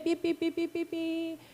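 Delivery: soft, slow imitated subway beeps; imitated beeping. 지하철 경고음 흉내. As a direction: mimic the warning chime softly.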